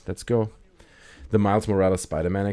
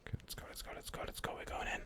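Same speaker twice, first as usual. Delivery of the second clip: urgent whisper; 18 dB quieter, faster than usual. Real, not acted. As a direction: push it on in a hush. Sneaking toward enemies.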